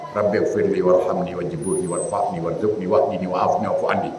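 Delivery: rattled off low and soft, words run together; hurried mumbled prayer. Shown as a demonstration. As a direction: rush the prayer under the breath carelessly. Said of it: "Cepat begitu kan?".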